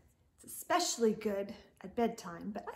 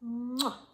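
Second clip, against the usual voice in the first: smacking kiss; playful kiss noise; kiss sound. Acted. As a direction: blow one more smooch. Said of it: "Then give one kiss more".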